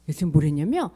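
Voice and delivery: usual speaking voice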